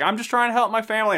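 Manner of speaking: a light mock of earnest pleading; Andy defending her family to Renee.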